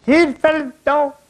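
high, loud Irish brogue; hearty Irish team-mate calling the lads; Irish player rallying the team, loud brogue; Irish accent. Retold or imitated. Irish team greets new captain.

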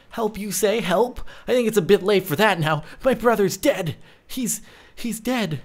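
mildly hammed-up outrage and grief; upset brother. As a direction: play a brother upset over a death. Acts the victim's upset brother.

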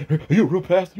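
mild mock of a pushy interviewer; mocking his question. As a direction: parrot his dumb question back with a sneer. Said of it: "starts asking stupid questions".

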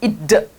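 "it เดอะ" with a full, voiced extra "duh" after it; over-released final d. Shown as a demonstration. Teacher showing students what to avoid, over-released final d. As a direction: overdo the ending of "it เดอะ" as a separate syllable. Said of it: "แต่ไม่ต้อง it เดอะขนาดนี้นะ".

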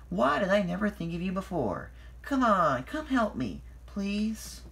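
high, insistent appeal; eager pleading. A narrator's reading. Thomas asks Boko for help, eager pleading. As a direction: plead high, rising to a coaxing please.

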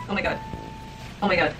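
ordinary talk, nothing marked

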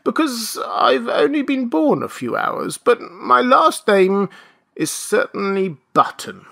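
put-on high-pitched, plaintive old man; fretful aged complaint; querulous whine. A narrator's reading. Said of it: "replied the querulous whine".

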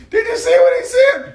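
very high, loud put-on shriek; mock scandalized woman. Woman reporting his remarks to a defender, mock scandalized. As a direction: screech it as a mocking imitation.

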